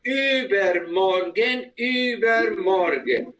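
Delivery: slow, drawn-out "Übermorgen"; slow modelling. Shown as a demonstration. Teacher models "Übermorgen" for student to repeat, slowly drawn out. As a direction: stretch out each part of "Übermorgen" for a learner.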